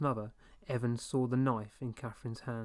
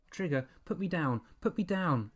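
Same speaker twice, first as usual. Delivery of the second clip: choked plea; 5 semitones higher than usual. A narrator's reading. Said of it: "Evan choked out".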